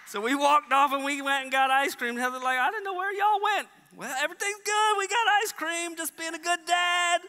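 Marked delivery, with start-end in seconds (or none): 2.6-7.2 mock casual. Retold exchange with wife.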